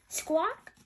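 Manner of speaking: high rising squawk; parrot squawk. Acted. Polly opens her beak.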